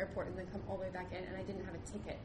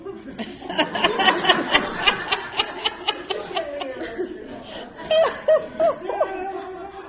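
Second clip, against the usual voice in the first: long rising burst of laughter; long loud laugh; 10 semitones higher, 15 dB louder than usual. Real, not acted. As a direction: laugh hard for a while. Laughing at own story.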